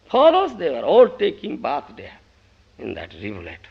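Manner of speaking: ordinary delivery, nothing marked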